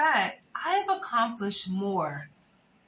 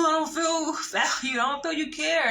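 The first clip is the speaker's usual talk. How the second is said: imitating him, higher and plaintive; whiny boyfriend; 6 semitones higher than usual. Quoting the boyfriend's emotional complaint, imitated higher and whiny. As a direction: act out his hurt complaint, a touch mocking. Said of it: "pouring out all these emotions".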